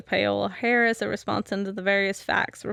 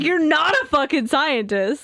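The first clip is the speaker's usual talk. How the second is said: mock angry; 7 semitones higher, 5 dB louder than usual. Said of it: "He's like".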